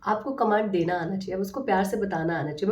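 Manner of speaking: normal talking voice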